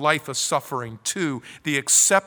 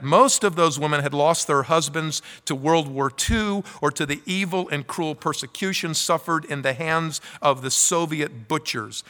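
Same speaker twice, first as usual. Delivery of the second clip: usual speaking voice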